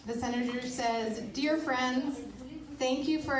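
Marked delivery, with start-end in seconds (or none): none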